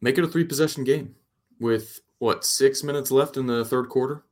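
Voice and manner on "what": normal talking voice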